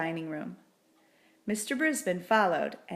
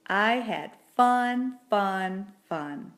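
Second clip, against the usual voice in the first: slow, louder delight fading down; joyful fun; 5 dB louder, much slower than usual. A narrator's reading. Humphrey gushing about his wonderful day. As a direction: savour each fun.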